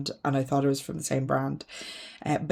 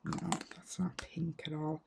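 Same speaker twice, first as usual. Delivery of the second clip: whisper; 12 dB quieter than usual. Real, not acted. Quiet aside while comparing shades.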